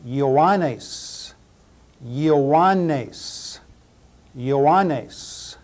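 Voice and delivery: Ioannes as yo-AH-ness; Ioannes, careful Greek syllables; Greek pronunciation. Shown as a demonstration. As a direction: Ioannes with Yanni audible inside. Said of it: "You can hear Yanni in there".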